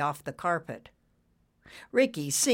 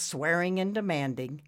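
usual speaking voice

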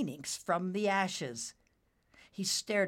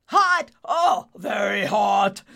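yelled; 3 semitones higher, 10 dB louder, slower than usual. Acted. Merlin yells as his sleeves catch fire. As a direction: yell it as the flames rise. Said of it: "Merlin yelled".

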